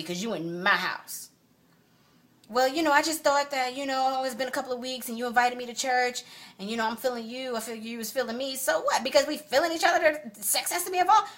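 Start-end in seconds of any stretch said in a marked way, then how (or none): 2.5-11.3 higher, rising to indignant disbelief; mock his excuse then explode; indignant mocking retelling; mocking outrage.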